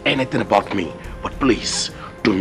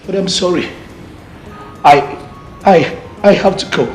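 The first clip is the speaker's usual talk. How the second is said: raised, anguished voice; loud upset declaration; upset outburst; 5 semitones higher, 4 dB louder than usual. Acted. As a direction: burst out that you're leaving. Pleading to leave the throne.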